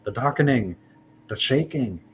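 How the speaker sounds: mock dread in short wailed phrases, kept down; muted panic. Panicked Augur to herself as the ground shakes, moaning dread. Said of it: "saying it not too loud".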